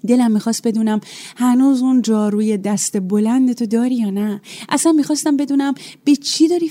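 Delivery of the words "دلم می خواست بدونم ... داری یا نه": usual speaking voice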